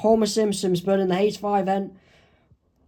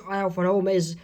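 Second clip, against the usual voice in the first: ordinary delivery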